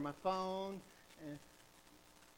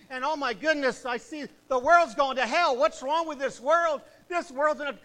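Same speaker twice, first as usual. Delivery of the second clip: louder preacher's lament, climbing; preaching; 5 semitones higher, 9 dB louder than usual. Acted. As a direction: preach it out against the world. Preaching at full force.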